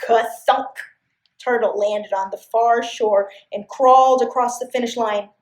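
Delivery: usual speaking voice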